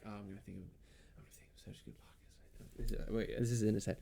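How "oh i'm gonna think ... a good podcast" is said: hushed whisper of his own thoughts; whispered inner voice. Retold or imitated. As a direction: breathe it like a secret thought.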